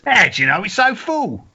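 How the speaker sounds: lightly hammed Cockney straight man; feed the line back in stage Cockney; Cockney accent. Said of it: "let me do a Cockney accent".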